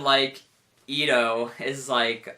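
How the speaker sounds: ordinary talk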